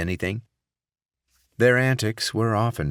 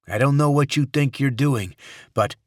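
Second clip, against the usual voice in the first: angry senator; 8 semitones higher, 5 dB louder than usual. Acted. Captive senator snaps at captor.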